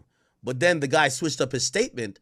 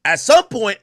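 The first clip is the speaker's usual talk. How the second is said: ordinary talk, nothing marked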